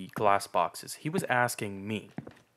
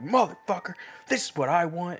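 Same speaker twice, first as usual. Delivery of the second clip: higher, louder mock rant from a customer storming in; mock angry customer; 8 semitones higher, 4 dB louder than usual. Rude customer storming in at the clerk, hammed-up angry. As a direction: ham up a mad customer barking his order. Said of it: "they come in storming mad".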